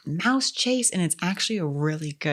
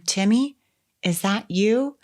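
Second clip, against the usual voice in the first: raised, tentative questioning call; wounded fawn; 3 semitones higher than usual. Retold or imitated. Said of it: "Wounded Fawn impression".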